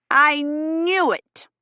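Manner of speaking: high, drawn-out outrage; indignant cry. A narrator's reading. Busybody storms down the corridor at them, drawn out and indignant. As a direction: burst out in indignant triumph. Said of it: "steaming down the corridor".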